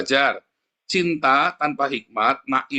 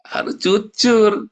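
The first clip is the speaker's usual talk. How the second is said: louder, slow, drawn-out plea; emphatic demand; 7 semitones higher, 6 dB louder, much slower than usual. Real, not acted. Preacher urges the congregation to be honest, slow and loud. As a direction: press each word on them, slowly.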